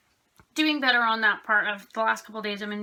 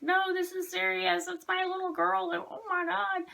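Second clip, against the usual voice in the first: imitating her own panicked pleading, higher; retold panic; 8 semitones higher, 4 dB quieter than usual. Owner retelling her panicked answer to the vet, mock frantic. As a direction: replay the frantic reply to the vet, pitched up. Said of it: "I'm like".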